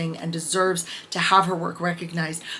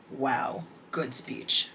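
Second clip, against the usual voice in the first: deadpan sarcasm; 6 semitones lower, 9 dB quieter, much slower than usual. Retold or imitated. Her note on the prince's speech.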